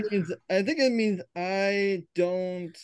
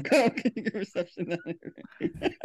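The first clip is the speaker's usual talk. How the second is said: laughing; 3 semitones higher, 3 dB quieter than usual. Real, not acted. Laughs while correcting the name.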